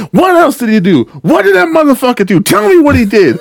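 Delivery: high, riled-up demanding questions; hyping them up. Retold or imitated. Worker egging on an angry caller, hyped up. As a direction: egg the caller on, high-pitched. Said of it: "I'd be hyping them up too".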